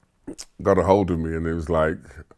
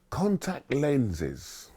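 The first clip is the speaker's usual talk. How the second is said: slow, soft and higher, drawn out; solemn career master; 6 semitones higher, 8 dB quieter, much slower than usual. Retold or imitated. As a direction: unveil it like a grand secret.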